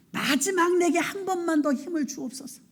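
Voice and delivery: high, impassioned plea to God; pleading prayer. Acted. Samson begs God for strength one last time, pleading. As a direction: raise the pitch and implore. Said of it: "삼손이 이렇게 기도합니다".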